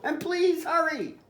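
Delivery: high-pitched plea to hurry; urgent boy's yell. A narrator's reading. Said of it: "I yelled".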